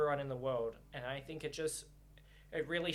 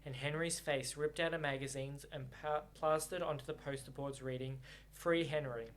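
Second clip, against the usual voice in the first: story narration; 3 semitones lower than usual. A narrator's reading. Reader narrating the novel to viewers, calm.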